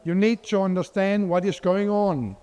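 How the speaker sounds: ordinary delivery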